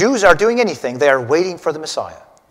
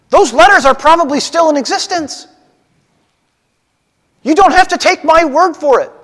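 higher, louder, earnest apostle voice; Paul pleading his case; 8 semitones higher, 3 dB louder than usual. Acted. Preacher voicing Paul to the crowd, raised and earnest. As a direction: speak up as Paul defending yourself to the crowd. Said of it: "Paul says".

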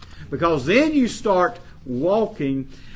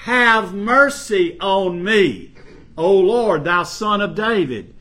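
loud, slow, higher pleading cry; crying out for mercy; 5 semitones higher, 8 dB louder, slower than usual. Acted. Canaanite woman crying to Jesus for her daughter, loud slow plea. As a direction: stretch each word out as a desperate plea. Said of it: "cried unto him, saying".

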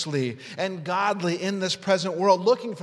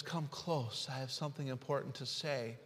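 much softer, gentle and low; still small voice; 5 semitones lower, 12 dB quieter than usual. Acted. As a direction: draw them close with a hushed voice. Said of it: "still small voice".

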